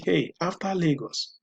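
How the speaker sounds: ordinary talk, nothing marked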